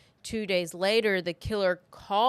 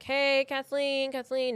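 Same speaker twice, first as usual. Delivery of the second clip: calling out; 6 semitones higher than usual. Retold or imitated. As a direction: call out her name, unsure anyone's home. Friend calling through the house.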